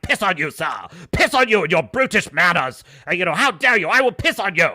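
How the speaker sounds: hammed-up indignant Englishman; tirade. Blackthorne ranting at the samurai, mock furious tirade. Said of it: "launches into a tirade".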